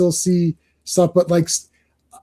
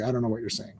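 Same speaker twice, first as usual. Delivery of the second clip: low, much softer aside; retold baffled reply; 6 semitones lower, 15 dB quieter, much faster than usual. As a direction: drop it low and quiet, acting lost.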